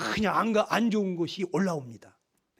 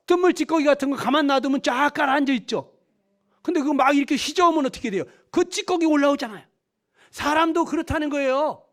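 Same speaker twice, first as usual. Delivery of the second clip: higher, emphatic sermon delivery; forceful preaching; 5 semitones higher, 4 dB louder than usual. Real, not acted. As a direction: press the illustration home with a raised voice. (목소리를 높여 힘주어 설교하듯)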